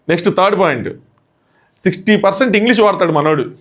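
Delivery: usual speaking voice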